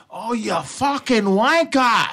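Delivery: high, slow, mock British curse at a driver; mock British insult. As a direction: sneer it like an angry British driver. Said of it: "people in traffic".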